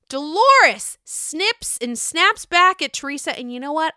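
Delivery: ordinary talk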